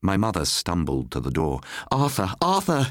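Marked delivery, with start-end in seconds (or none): 1.9-2.9 crying out. A narrator's reading. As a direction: call him back into the snow. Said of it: "she cried".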